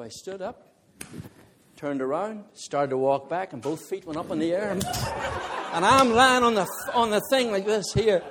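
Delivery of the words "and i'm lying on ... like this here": high, loud laughter breaking into the words; laughing loudly. Real, not acted. Preacher telling the church how he fell, laughing hard. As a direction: crack up while describing the fall. Laughing about falling on the lane.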